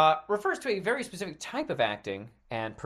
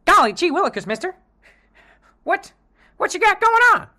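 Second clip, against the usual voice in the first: put-on high, eager gee-whiz boy; wholesome 1950s kid; 10 dB louder, faster than usual. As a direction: innocent golly-gee schoolboy voice. Sketch, kid meets alley dealer.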